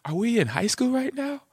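high, incredulous "are you serious" pitch; exasperated disbelief. Retold or imitated. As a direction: sound fed up and unable to believe it. His own exasperated retort.

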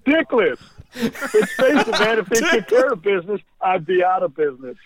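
high-pitched movie character impression; put-on high voice, quoting for laughs; Bill Paxton. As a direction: play the cocky line way up high. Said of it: "wanted to do a Bill Pa".

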